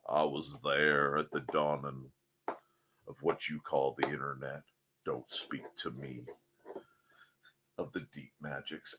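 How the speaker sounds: put-on low, quiet, solemn old wizard voice; mock ancient sage. Streamer boasting to chat of internet origins, low hushed wizard voice. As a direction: intone like a weary ancient mage.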